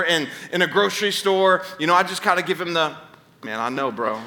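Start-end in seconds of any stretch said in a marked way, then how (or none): 3.4-4.2 low, soft aside to a fellow dad; hushed, much lower acted murmur; knowing sympathy. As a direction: commiserate quietly, man to man. Gives struggling dad knowing sympathy.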